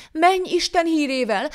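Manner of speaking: high, slow, drawn-out farewell; dismissive send-off. A narrator's reading. Poor man releasing the begging devil, lofty dismissal.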